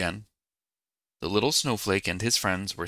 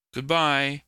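high, louder drawn-out farewell call; 7 semitones higher, 6 dB louder than usual. A narrator's reading. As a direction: call goodbye to friends drifting away. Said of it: "the little snowflake called out".

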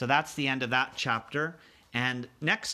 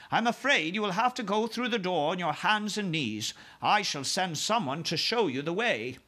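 higher-pitched, courteous royal voice; composed Queen; 6 semitones higher than usual. Acted. Queen directs the giant to breakfast, courteous. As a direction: explain politely as a gracious monarch.

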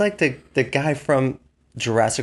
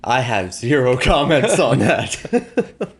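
laughing; 5 semitones higher, 5 dB louder, much slower than usual. Real, not acted. Laughing refusal to comment.

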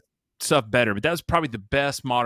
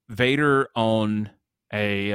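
ordinary talk

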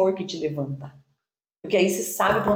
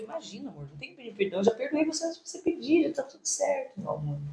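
soft tender reply; 3 semitones higher, 8 dB quieter than usual. Retold or imitated.

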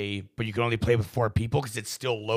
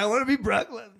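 half-laughing wish; 12 semitones higher, faster than usual. Real, not acted.

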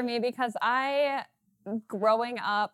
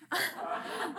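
short laugh; 9 semitones higher, 3 dB quieter than usual. Real, not acted. Laughs about mom ruining life.

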